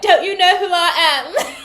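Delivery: high, loud, drawn-out indignation; mock offended outrage. Offended ex pulling rank, hammed-up shrieking outrage. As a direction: shriek it haughtily, half laughing. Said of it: "somebody feels offended that you left their ass".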